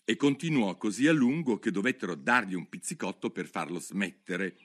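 ordinary delivery, nothing marked

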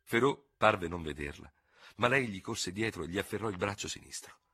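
ordinary delivery, nothing marked

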